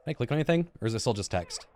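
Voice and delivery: normal talking voice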